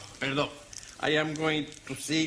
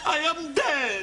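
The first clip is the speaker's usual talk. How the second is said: mock panic; 8 semitones higher, slower than usual. Panicking over thermometer.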